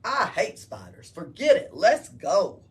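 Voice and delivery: loud, jumpy soldier wanting out; loud scared soldier. Acted. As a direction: blurt it loud, itching to flee. Soldier scared, wants to leave.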